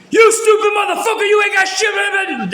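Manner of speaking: imitating a guard screaming at an inmate; furious yell. Officer at the door screams at the new inmate, furious shout. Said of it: "everyone's hearing him talking like that".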